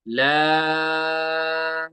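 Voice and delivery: ला stretched long on an even pitch; three-alif elongation. Shown as a demonstration. Teacher reciting बा ला बा for the class, ला drawn long. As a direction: hold ला for three counts. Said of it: "लाम को तीन".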